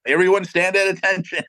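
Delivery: hammed-up reverence, as if a celebrity just walked in; mock awed. Band crew suddenly impressed by Cynthia, mock starstruck gasp. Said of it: "the whole demeanor changes".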